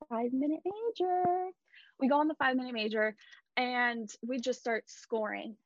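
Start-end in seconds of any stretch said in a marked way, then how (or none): 0.1-1.5 higher, sing-song gloating taunt; mock taunt. Taunting the Vegas fans about the penalty, gloating sing-song. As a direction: rub it in like a smug fan.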